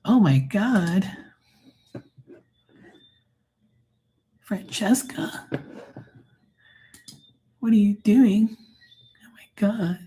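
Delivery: high, slow gasps sliding into a breathy whisper; horrified owner. Retold or imitated. Owner staring at her groomed dog, high horrified whisper. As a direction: gasp in disbelief, then whisper it.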